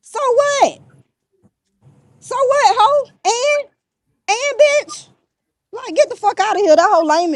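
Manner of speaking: very high-pitched, squeaky taunt; mocking taunt. Real, not acted. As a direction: fling it out in a high, sing-out squeal. Taunting an absent rival.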